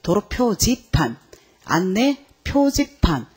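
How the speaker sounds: '표지판'을 음절마다 느리게 늘여 읽기; '표지판'의 '표'를 길게, '지판'은 또박또박; 올바른 읽기 시범. Shown as a demonstration. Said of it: "요거는 표지라고 읽어야 돼요". ('표지판'을 천천히 끊어서)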